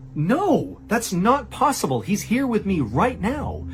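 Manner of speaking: high-pitched imitation of a disbelieving wife; retold stunned denial. Stunned wife denies the news.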